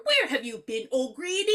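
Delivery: put-on strong Irish accent. Host as the wife to O'Grady, bad Irish accent. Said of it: "they have the strongest accents ever".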